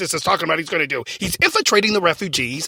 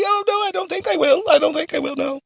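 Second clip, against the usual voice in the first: imagined visa bride; 7 semitones higher than usual. Acted. Bride answers the official's question about killing, high mock-innocent voice. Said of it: "You can just come in and say".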